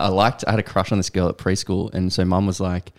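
ordinary talk

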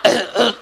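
long run of imitated throat clearing; mimicked throat clearing. Wife imitates husband interrupting himself, endless throat clearing. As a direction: hack and clear the throat over and over. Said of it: "you try to have a conversation with him".

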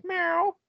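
high imitated meow; cat meow. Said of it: "Cat Ghoulie like".

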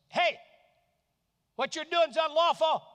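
loud, high accusing call. Acted. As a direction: shout it across the palace. John calling out Herod.

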